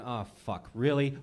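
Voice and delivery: low groan of letdown on "Ah, fuck", then a flat "really?"; mock disappointed wife. Wife waking beside him, disappointed groan. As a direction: grumble like a let-down wife. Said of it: "my wife looking at me ... going".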